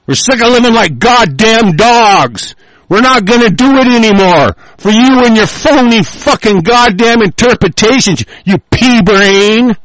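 high, yelled real tirade; enraged shouted rant; furious shouting. Real, not acted. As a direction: order them out at the top of your anger. Furious tirade, ordering opponents away.

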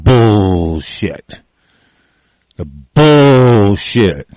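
very slow, stretched-out curse; long, emphatic dismissal; drawn-out contempt. Real, not acted. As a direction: drag out the first syllable with scorn.

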